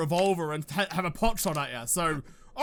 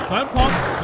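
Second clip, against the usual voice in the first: higher louder don't pop; louder urgent plea; 3 semitones higher, 8 dB louder than usual. Real, not acted. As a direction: beg it not to burst. Zombie about to explode.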